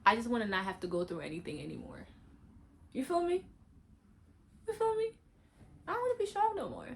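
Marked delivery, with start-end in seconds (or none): 2.9-6.9 high, fast pleading cry; plaintive high plea. Real, not acted. Venting to her viewers about being strong, high pleading. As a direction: pitch the plea up, almost breaking.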